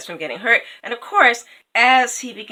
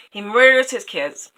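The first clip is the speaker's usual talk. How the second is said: plain reading voice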